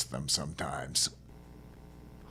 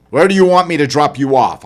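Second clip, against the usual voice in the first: raised voice, loud and high; calling over the engine noise; 7 semitones higher, 12 dB louder than usual. Acted. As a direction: call it across the cab. Narrator asks stowaway, loud over noise.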